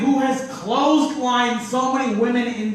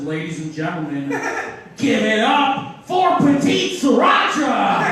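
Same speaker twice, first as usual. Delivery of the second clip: hammed-up MC intro, rising to a loud shout; mock host announcement; 3 dB louder than usual. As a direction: announce the wrong name like a cocky host. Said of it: "He goes on stage and says".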